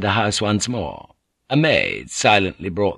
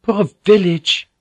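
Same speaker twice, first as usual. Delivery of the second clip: higher, slow, pitying; sorrowful sigh; 11 semitones higher, 5 dB louder than usual. Acted. Mariko pities the village, slow and sorrowful. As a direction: let the pity ache in it.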